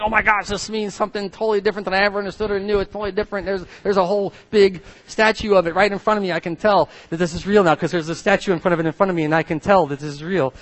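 fast, high excited rush; excited freaking out. Retold or imitated. Father at museum retelling his excitement, high and fast. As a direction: gush in amazed excitement.

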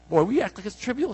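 ordinary delivery

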